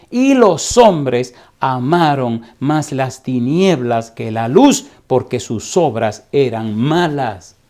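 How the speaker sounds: slow, loud pulpit reading building to a shout; fiery preaching. Acted. Preacher to the congregation, slow and loud building to a shout. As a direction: thunder the verse at the congregation. Preaching scripture at full force.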